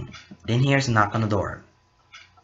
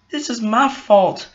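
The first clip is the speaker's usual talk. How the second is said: higher, louder, slow tearful lament; crying; 11 semitones higher, 6 dB louder, slower than usual. Acted. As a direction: moan it slowly as if in tears. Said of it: "cries more".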